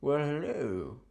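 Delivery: smarmy "well, hello" from a pencil-mustache charmer; a hammed-up lounge-lizard hello; suave thin-mustache voice. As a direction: purr the greeting like a cartoon smoothie. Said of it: "Who would talk like that?".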